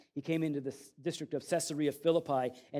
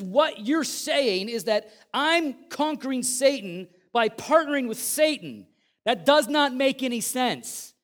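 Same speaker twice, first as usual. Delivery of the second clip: forceful rebuke; 6 semitones higher, 5 dB louder than usual. Acted. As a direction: hammer the Pharisees with the logic. Jesus voiced, rebuking Pharisees.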